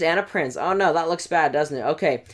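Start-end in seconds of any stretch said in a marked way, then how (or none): none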